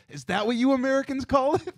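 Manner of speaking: high-pitched, incredulous imitation; mock incredulity. Canadians teasing an American for saying pop, mock incredulous. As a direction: mimic a foreigner amused at American slang.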